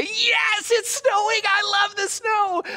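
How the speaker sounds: high, giddy cry of delight; gleeful snow cheer. Retold or imitated. Pastor mimicking his own winter delight, high and giddy. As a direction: squeal it like a kid seeing snow.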